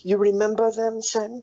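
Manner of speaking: high, insistent question; excited prompting. Acted. Bullywug presses Sin to remember his companions, excited.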